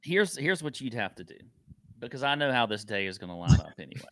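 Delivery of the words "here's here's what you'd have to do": ordinary talk, nothing marked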